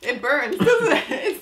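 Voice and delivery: laughing through the burn of spice; high, laughing gasp at the heat. Real, not acted. Spicy snack burns, laughing.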